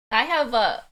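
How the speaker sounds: normal talking voice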